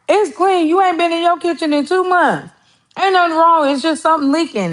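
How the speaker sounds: high, insistent sister voice; sister's voice. Retold or imitated. Sister insisting the kitchen is clean, high voice.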